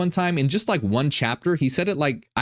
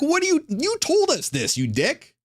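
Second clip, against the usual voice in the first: very high, indignant mock whine; hammed-up outrage, pitched way up; pissed archons; 14 semitones higher than usual. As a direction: accuse him like a betrayed underling. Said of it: "So they're pissed".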